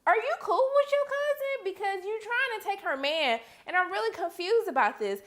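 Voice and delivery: put-on high, incredulous scolding of the girl; high mock-scolding girlfriend voice; sassy disbelief. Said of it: "I was like".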